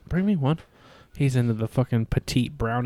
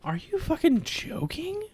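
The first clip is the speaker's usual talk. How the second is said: breathless disbelief; incredulous whisper; 14 semitones higher, 4 dB quieter than usual. Real, not acted. Incredulous reaction to question.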